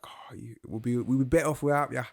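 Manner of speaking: attempted Irish accent. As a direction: have a go at an Irish accent, pushed high. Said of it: "I can't do an Irish accent".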